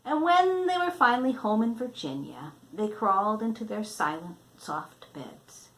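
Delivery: reading a picture book to a grandchild; storybook reading. Acted. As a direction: read it out gently, like a bedtime story. Grandmother reads picture book aloud.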